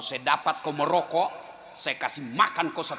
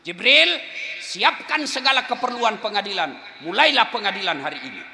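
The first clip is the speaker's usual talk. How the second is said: commanding call; 7 semitones higher, 7 dB louder than usual. Acted. Divine voice commanding Jibril.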